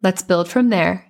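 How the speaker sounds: calm, measured delivery with long pauses; guided meditation. Real, not acted. Coach leading moms through a guided meditation, calm and unhurried. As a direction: guide them gently inward, phrase by phrase.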